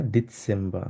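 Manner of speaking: ডিসেম্বর as "dit-sem-ba". Shown as a demonstration. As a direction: model ডিসেম্বর with a TS in the middle. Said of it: "সেট কে এস এর মতো উচ্চারণ". (ডিসেম্বর-কে "ডিৎসেম্বা")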